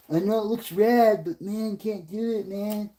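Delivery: high, put-on pleading voice; hammed-up high-voiced objection; mock Californian objector. Same acted objector continues.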